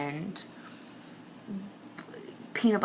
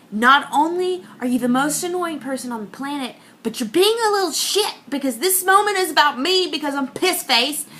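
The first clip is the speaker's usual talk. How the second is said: exasperated outburst, pitched up; mock-furious high complaint; acted angry rant; 7 semitones higher, 4 dB louder than usual. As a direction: rant at your partner, building to a louder peak. Acted angry rant at her partner.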